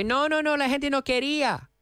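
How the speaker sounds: high-pitched mock protest; correcting native speakers. Spanish speakers correcting a foreigner's grammar, high insistent protest. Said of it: "And they'll say".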